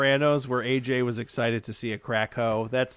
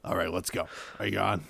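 low, quiet Howard Stern-style command; bossy radio host; 6 semitones lower, 7 dB quieter than usual. Retold or imitated. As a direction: boss Kyle around on air. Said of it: "we just tell Kyle to do things like".

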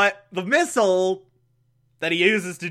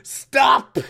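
heroic shout; 4 semitones higher, much slower than usual. Retold or imitated. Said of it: "are like".

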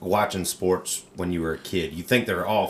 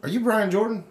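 excited fan; 4 dB louder, faster than usual. Retold or imitated. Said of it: "And I was like".

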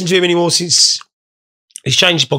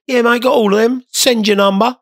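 mock scammer; 6 semitones higher than usual. Acting out the scammer reply.

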